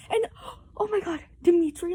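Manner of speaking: high cry of dismay; upset gasp and cry; gasping oh my god. Real, not acted. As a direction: gasp then cry out the name. Gasp, distressed outburst.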